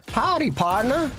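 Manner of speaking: high, slow sing-song cowboy howdy; cowboy robot greeting. Retold or imitated. The Fallout New Vegas robot greeting a newcomer, slow and high. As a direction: drawl out the greeting like a friendly robot. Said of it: "the robot goes".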